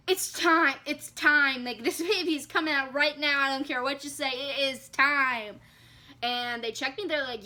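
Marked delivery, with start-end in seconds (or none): 0.1-5.6 louder, urgent retold demand; insistent announcement. Telling staff this baby's coming out right now, insistent. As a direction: announce it emphatically, no arguing allowed.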